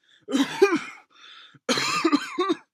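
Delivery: loud, high hacking cough; a drunk man wracked by coughing; violent coughing fit. A narrator's reading. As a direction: cough hard and long, unable to stop.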